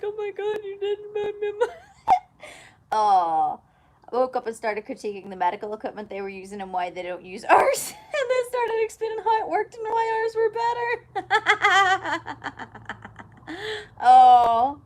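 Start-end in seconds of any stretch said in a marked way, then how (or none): 0.0-1.7 high, squealing, tearful-sounding delight; overjoyed. Retold or imitated. Patient given a Monster drink, overwhelmed high delight. Thrilled at gift, re-enacted. 7.4-12.4 slow, high, talking through real laughter; laughing. Real, not acted. Telling her own medicated antics, helpless laughter.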